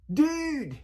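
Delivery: very high, loud, thrilled "Dude!"; congratulating dude. Shown as a demonstration. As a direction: cheer "Dude!" for a friend who got the job. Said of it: "take the place of congratulations".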